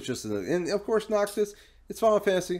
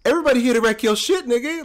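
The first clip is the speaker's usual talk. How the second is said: higher, louder, fast hype; hyped up; 8 semitones higher, 4 dB louder, faster than usual. Retold or imitated. Hyping the crossover to friends, higher fast excited. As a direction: rattle it off, hyped.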